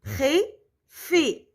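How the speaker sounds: "Préfet" split into two separate syllables; "Préfet" as pré, pause, fé. Shown as a demonstration. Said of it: "Donc, qu'est-ce qu'on dit?".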